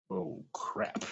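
hushed, sinking dread; soft whispered alarm, half under the breath; dismayed whisper. Retold or imitated. Huge stranger boards, private dread.

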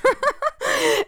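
high bright burst of laughing; burst of laughter. Real, not acted. Laughing at having sworn on air. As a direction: laugh out loud at yourself. Laughs at her own cursing.

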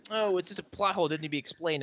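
high, dismissive imitation of fans; dismissive mock quote. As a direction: toss it off like a know-it-all.

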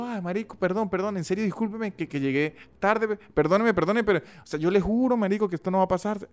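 high, pleading gocho Andean Venezuelan accent; gocho apology. Shown as a demonstration. Apologizing in a voice note for arriving late, in a high pleading gocho accent. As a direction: beg forgiveness sweetly in a gocho accent. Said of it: "usted envía un mensaje de voz hablando así, gocho, como yo".

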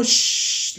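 a long hissed shush. Retold or imitated. Student shushing teacher before whole class, hissed shush. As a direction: hush someone insolently. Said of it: "le hice así".

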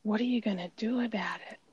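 put-on mother calmly asking her child what she'll do; empathetic comfort. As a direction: soothe her, then hand the problem back kindly. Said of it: "I gave her all the empathy and I said".

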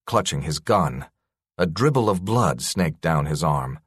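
ordinary delivery, nothing marked

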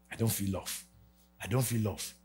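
low, soft, downcast; mopey quote. Retold or imitated. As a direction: mope like someone unloved. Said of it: "rather than".

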